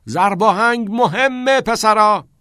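slow, high, very loud call; shout over music. A narrator's reading. Keating to the boys over the loud gramophone, shouting. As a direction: stretch it out so it carries over the music. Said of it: "از ورای صدای بلند موزیک فریاد زد".

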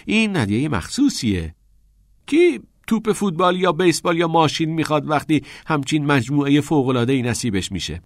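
high, animated mock sales pitch; exaggerated excitement, speeding up at the end; joking enthusiasm. A narrator's reading. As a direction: talk up a dull gift to cheer a friend. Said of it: "سعی کرد لبخند بزند".

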